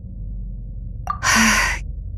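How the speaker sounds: slow, soft exhale; long breathy sigh. Acted. Lost child fails to remember, long soft sigh. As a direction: let out a long, quiet sigh.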